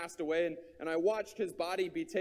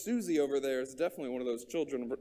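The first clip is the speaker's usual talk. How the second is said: low, fast smug aside about Susie; mock finger-pointing; 7 semitones lower, much faster than usual. Preacher mocking the congregation's habit, smug low aside. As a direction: pin the sin on someone else, smugly. Mocks blaming others, then corrects.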